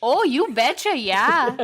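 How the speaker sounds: hammed-up Minnesotan; Minnesota accent. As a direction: put on an exaggerated Minnesota accent. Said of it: "talk like that in Minnesota".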